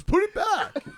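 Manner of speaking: high, squeaky "Put it back"; panicked mock order. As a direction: imitate the friend's alarmed yelp. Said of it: "He goes".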